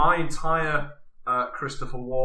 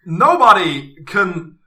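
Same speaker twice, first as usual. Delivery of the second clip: slow, halting delivery with loud, raised stresses; Christopher Walken impression; 5 semitones higher, 8 dB louder, slower than usual. Podcaster put on the spot does one ninja line, Walken impression. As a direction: put big pauses between phrases, punching each one. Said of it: "really well in Christopher Walken's voice".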